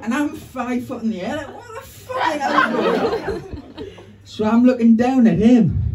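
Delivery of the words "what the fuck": much higher, stretched out in disbelief; shocked. Retold or imitated. Comic stranded midair on the seesaw, shocked. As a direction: wail it from up in the air.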